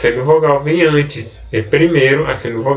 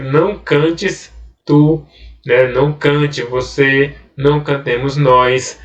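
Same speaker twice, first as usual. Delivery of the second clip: slow, deliberate example read-out; slow demonstration; slower than usual. Shown as a demonstration. Teacher showing the negative imperative, slow and careful. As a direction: drag out the example words. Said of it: "imperativo negativo".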